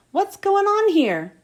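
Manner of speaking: gasping. Acted. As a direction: gasp it out in shock. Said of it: "McQueen gasped".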